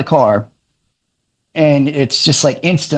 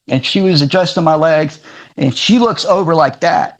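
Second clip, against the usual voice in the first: normal talking voice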